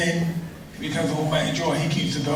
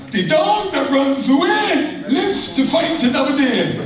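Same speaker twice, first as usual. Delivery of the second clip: higher, slow imitation of dad's Bajan accent; 8 semitones higher than usual. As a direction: mimic father's Bajan accent, slow and high. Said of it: "in his Bajan accent".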